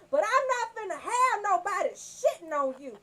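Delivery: usual speaking voice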